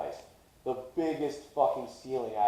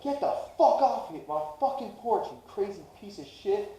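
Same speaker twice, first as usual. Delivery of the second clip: much higher, heated mock outburst; retold angry yell; 9 semitones higher than usual. Mom throwing church man off porch, angry yell. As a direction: act out an outraged mother. Mom's furious retort, retold.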